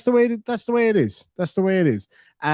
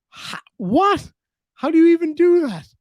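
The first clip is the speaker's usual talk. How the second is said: shocked disbelief; 13 semitones higher, 4 dB louder than usual. Real, not acted. Absurd chat story.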